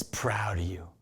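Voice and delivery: hushed, low, intimate assurance; tender whisper. Real, not acted. Preacher assuring listeners of God's pride, whispered. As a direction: let it sink to a heartfelt whisper.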